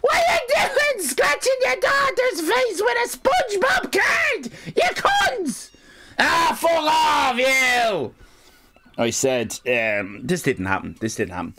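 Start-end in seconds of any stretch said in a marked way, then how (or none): 0.0-5.7 piercing, wailing scolding shriek; hammed-up high-pitched fury; Miss Sally Fan. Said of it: "Miss Sally Fan, of course, is going". 6.2-8.1 high, drawn-out shouted retort; hammed-up exasperated shout; angry comeback. Retold shouted comeback in quarrel.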